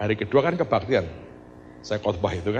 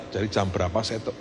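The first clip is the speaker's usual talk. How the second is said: normal talking voice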